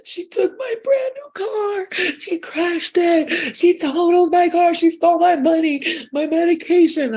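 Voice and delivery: high, loud, slow wailing of the robbed friend; hysterical friend mimicked. Friend on the phone wailing about her crashed car, high and loud. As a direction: mimic her panicked, stretched-out wail, way up high. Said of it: "She was like".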